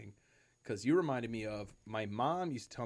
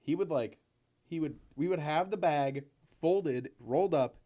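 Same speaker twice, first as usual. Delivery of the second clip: ordinary talk, nothing marked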